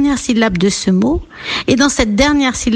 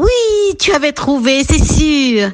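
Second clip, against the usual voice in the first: delighted praise; 5 semitones higher than usual. Pretend. Teacher congratulates an unseen child, delighted and high. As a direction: celebrate the right answer, pitched up.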